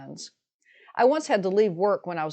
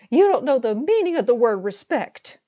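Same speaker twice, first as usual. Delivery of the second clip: loud, higher, indignant retort; yelling; 5 semitones higher, 6 dB louder than usual. Retold or imitated. Sister-in-law leaps off the bed yelling at the narrator. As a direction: fling it back at her, loud and high. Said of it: "even though she was yelling".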